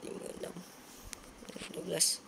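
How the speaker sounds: ordinary talk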